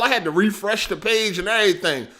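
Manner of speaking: ordinary delivery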